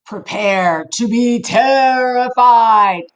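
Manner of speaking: slow, drawn-out mock-spooky announcement; mock spooky warning. Reader teasing the children before the gross part, slow mock-spooky warning. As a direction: ham up the threat, slowly. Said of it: "if you're still here".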